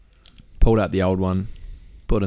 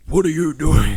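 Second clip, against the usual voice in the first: higher, stern mock father; imagined angry dad; 7 semitones higher, 4 dB louder than usual. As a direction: confront him like a suspicious dad. Imagined girlfriend's dad intervening.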